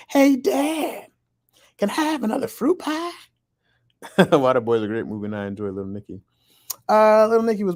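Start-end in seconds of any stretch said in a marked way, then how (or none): 0.1-3.1 very high cartoon boy voice; Bobby Hill. Cartoon son asking his dad for pie, high boy impression. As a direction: imitate a cartoon kid asking, pitched way up. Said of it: "Bobby Hill voice from King of the Hill".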